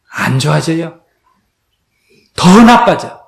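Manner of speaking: normal talking voice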